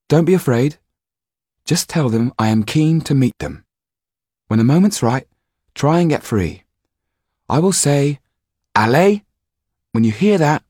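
calm the scared actor; girl Jan, reassuring. A narrator's reading. Jan tells the actor her plan, reassuringly.

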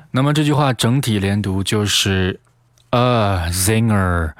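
normal talking voice

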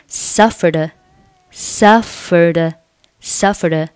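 "suffered" run into a following "a", as "suffer-duh"; linked consonant and vowel. Shown as a demonstration. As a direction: carry the d of "suffered" into a short "a". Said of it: "suffered和后面的a构成了辅元".

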